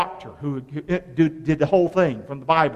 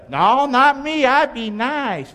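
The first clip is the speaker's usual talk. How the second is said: higher, drawn-out mock-innocent protest; 5 semitones higher, much slower than usual. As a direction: imitate someone piously insisting on their own virtue. Voices congregation's self-righteous protest.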